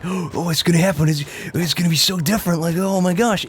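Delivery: big it up like a breathless fan; mock hype. Player mocking hype over his normal voice, overexcited. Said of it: "I don't wanna big it up like".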